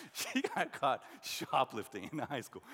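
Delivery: loud, slow, through laughter; tickled, breaking into laughs; amused laughing. Real, not acted. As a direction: laugh while you tell it, stretching the words. Amused telling of sister's shoplifting.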